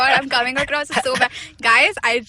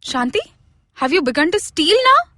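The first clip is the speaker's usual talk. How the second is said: high, loud accusing madam; accusing employer; 5 semitones higher, 3 dB louder than usual. Acted. Madam accusing her maid, high and outraged. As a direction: confront the maid, pitched up. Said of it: "I need a pause over here".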